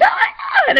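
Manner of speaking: very high shout, laughing and crying at once; reliving the moment she saw the plus sign, shrieking with joy. Retold or imitated. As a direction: scream it out in tearful joy. Her reaction to positive test.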